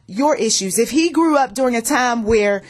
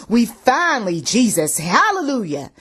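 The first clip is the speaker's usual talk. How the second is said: usual speaking voice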